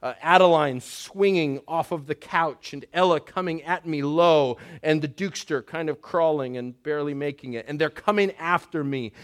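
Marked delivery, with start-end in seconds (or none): none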